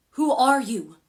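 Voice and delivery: loud, high, forceful question; demanding. A narrator's reading. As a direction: demand an answer from an intruder. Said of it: "Piper demanded".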